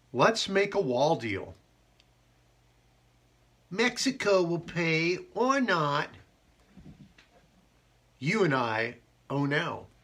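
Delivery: a slow, loud mock Trump voice; understated Trump voice, drawn out; Donald Trump impression. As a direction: do a lightly hammed Trump. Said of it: "That's my Donald Trump impersonation".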